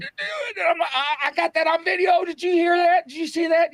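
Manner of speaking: very high shrieking excitement; retold freak-out. Guest retells hearing the tape rip on video, shrieking. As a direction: squeal breathlessly to the viewers, pitch sky-high.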